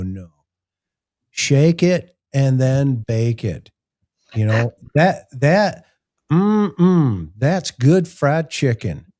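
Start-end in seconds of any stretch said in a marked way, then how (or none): 1.3-3.7 drawn-out slow shake it and bake it; slow jingle delivery; slow catchphrase. Shown as a demonstration. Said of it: "shake and bake". 5.0-9.1 higher, slow savoring ad line; hammed-up fried chicken ad; mock commercial voice. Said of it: "that commercial".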